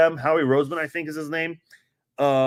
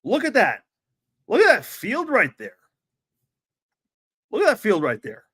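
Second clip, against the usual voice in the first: incredulous; 5 semitones higher than usual. Real, not acted. Ranting at the bad field.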